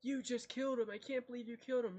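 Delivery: high, loud outraged cry; shocked yell. Acted. Bandits scream at Leaf for killing their brother, in high outraged shock. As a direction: scream it in disbelief at the killer. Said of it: "And they go".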